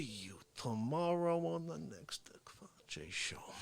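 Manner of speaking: breathy whispered sign-off. Acted. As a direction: sneak the goodbye out in a whisper.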